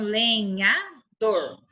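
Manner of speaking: LENHADOR split slowly into le, nha, dor; slow, separated syllables in "lenhador". Shown as a demonstration. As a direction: say "lenhador" with a gap between syllables. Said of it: "Cada uma dessas sílabas aqui".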